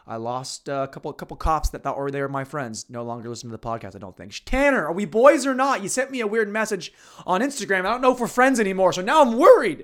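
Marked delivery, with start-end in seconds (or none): 4.4-9.8 high, loud, whiny plea; mock pleading. Pleading loudly to his cop friend on the podcast. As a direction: beg your cop buddy, over the top.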